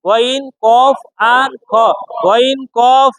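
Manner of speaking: "গইন, কফ আর খ" slow, with rounded o vowels; heavy letter pronunciation. Shown as a demonstration. Teacher leading students' repetition, slow heavy "গইন, কফ আর খ". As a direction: stretch "গইন" and "কফ", keeping them heavy. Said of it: "উচ্চারণটা মোটা হবে".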